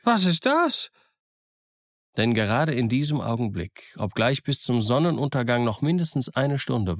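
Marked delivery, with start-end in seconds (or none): 0.0-0.9 higher, sudden startled call from the castaway; a startled, higher-pitched what's that; startled cry. Acted. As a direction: jump at the sound of the gun. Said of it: "rief er plötzlich".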